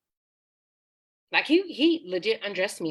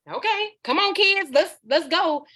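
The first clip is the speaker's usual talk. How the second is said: high, raised-voice call, imitating mom; calling the kids; 10 semitones higher, 5 dB louder than usual. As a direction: round up the children to leave. Mother calling her kids to leave.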